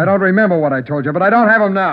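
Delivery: agitated shout. Acted. Rattled suspect shouting at detectives about the shoes. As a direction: push back, cornered and rattled.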